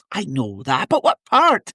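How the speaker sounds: rising into high, insistent Scottish; imitated impatient Scottish mother. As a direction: press him as a wee Scottish mum. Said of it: "And she's like".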